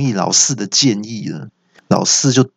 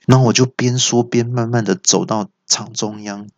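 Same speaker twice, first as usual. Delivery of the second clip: plain reading voice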